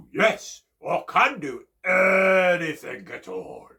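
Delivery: loud, slow boast; proud bear. Acted. Bear insisting to Chipmunk, loud and slow. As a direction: boom it out proudly. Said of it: "and he said".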